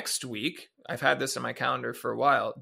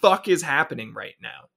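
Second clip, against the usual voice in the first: retold outburst; 8 dB louder than usual. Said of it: "was like".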